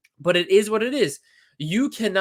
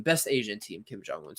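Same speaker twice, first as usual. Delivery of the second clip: much softer and lower, trailing away; mock fading grovel; 7 semitones lower, 8 dB quieter than usual. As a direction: mumble the last flattery under your breath.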